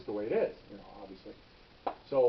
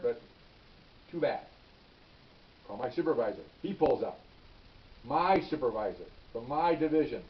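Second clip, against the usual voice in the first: usual speaking voice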